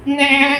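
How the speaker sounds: high imitated bleat saying no; nanny goat bleat. Comedian playing the nanny goat refusing, high bleat. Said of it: "कहती".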